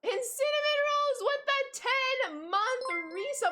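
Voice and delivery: high, slow, drawn-out hype call; excited shout-out. Real, not acted. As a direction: stretch the name like an announcer. Streamer hyping a ten-month resub.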